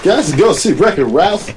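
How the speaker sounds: happy cartoon voice. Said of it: "Sound like a happy cartoon".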